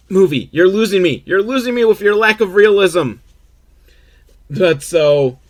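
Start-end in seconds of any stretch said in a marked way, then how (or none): 0.1-3.1 exasperated complaint. Real, not acted. Podcaster scolding the movie aloud.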